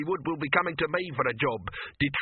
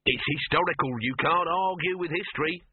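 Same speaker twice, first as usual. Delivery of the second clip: smug, raised pitch; 6 semitones higher than usual. A narrator's reading. Dibbler justifies his script to Silverfish, smug. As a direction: say it as if the argument is won. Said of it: "said Dibbler smugly".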